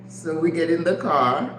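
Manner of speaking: usual speaking voice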